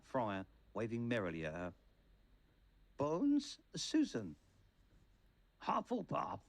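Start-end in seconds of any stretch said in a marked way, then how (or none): 3.0-4.4 high-pitched calling out of a name; surname pitched high, first name dropping; roll call. A narrator's reading. Teacher calling the roll of names. 5.6-6.4 high-pitched theatrical announcement; high cry falling away at the end; hat's shout. A narrator's reading. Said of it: "shouted the hat again".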